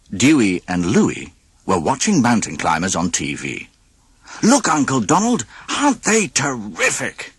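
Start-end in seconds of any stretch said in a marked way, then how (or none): 4.4-7.3 excited nephew. A narrator's reading. Nephew excited about climbers.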